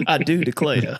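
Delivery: hammed-up Southern accent; mock Cajun accent. Mocking the coach's newly acquired accent, mock Cajun Southern. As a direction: play up a fake Southern accent. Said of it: "in his newly acquired Cajun accent".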